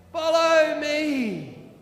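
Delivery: slow, loud, drawn-out leader's call; slow Mister T call. Acted. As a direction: stretch each word like a wise old guide. Mister T leads the cars.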